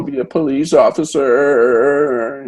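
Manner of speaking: lightly voiced whiny complaint; imitating a petulant daughter, slow; mock whining. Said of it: "Zoe whines more about her father".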